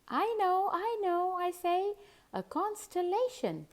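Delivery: put-on a little girl bursting to answer first; hand-waving keen pupil blurting the answer; eager child.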